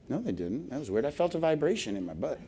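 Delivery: hushed private wondering; puzzled self-talk. Acted. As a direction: drop the voice, quietly baffled.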